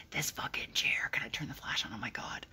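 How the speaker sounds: hushed secret whisper. Real, not acted. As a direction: whisper so he won't hear. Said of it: "I'm sorry I'm whispering".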